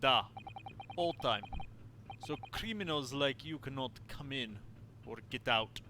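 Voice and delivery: gruff Russian guard; smug Russian seaman; Russian accent. Acted. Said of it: "sorry to literally anybody who actually has a Russian accent".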